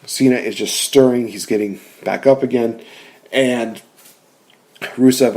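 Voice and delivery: normal talking voice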